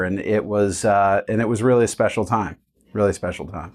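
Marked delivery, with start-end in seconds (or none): 2.9-3.8 quieter, lower, tender repeat; a soft murmured afterthought; soft fond echo. Real, not acted. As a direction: savor it quietly, almost to yourself. Fond echo of own words.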